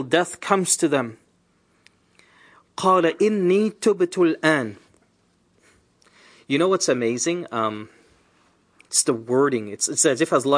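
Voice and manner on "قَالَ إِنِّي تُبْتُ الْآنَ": fast melodic Quranic recitation; تلاوة القرآن بسرعة. Acted. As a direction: recite the verse in Arabic, fast and flowing.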